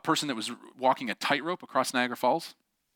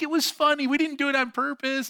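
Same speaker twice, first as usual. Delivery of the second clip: high, loud imitation of amused sisters; half-amused sisters; 3 dB louder than usual. Sisters kinda chuckling at their fallen brother, half-amused. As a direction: excuse it in a high, tickled voice. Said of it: "they're, like, kinda chuckling".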